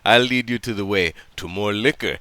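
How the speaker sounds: low, soft character voice; Yamdo coaxing. Acted. Yamdo lures drunk man with liquor, low and coaxing. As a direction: coax a drunk friend along in short phrases.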